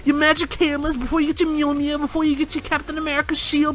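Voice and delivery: excited pleading. Real, not acted. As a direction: beg the angry fans to wait, rising. Pleading fans to hold on.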